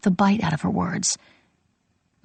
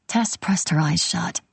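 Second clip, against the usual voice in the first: ordinary delivery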